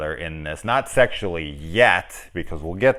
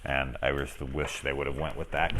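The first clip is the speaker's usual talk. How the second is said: usual speaking voice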